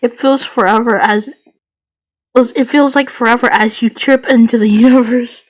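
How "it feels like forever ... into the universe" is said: usual speaking voice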